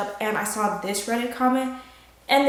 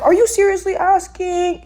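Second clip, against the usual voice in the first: incredulous; 6 semitones higher, 5 dB louder than usual. Retold or imitated. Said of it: "It's like".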